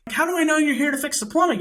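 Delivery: high, fast retort; retold heated demand through a door; agitated challenge. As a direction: fire back fast and high, disbelieving. Retold argument through the closed door.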